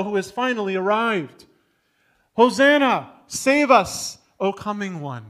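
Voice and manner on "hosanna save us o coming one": pleading shout that fades on the last words; crowd crying out. Retold or imitated. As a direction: call out like the crowd at the gate.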